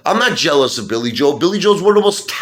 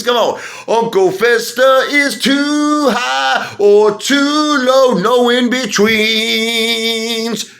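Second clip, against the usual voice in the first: high, slow put-on voice; mock posh voice; 8 semitones higher, 3 dB louder, much slower than usual. Vlogger mocks Lou to viewers, high slow put-on voice. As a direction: drawl it out like a haughty socialite.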